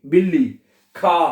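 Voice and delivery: usual speaking voice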